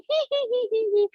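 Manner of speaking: very high hee-hee-hee; giggling exercise laugh. Shown as a demonstration. Teacher keeping laughter going, high giggle. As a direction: titter high and quick. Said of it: "keep laughing".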